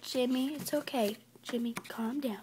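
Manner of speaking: soft, hushed reassurance; gently calm someone who is screaming; quiet comforting murmur; soothing. Acted.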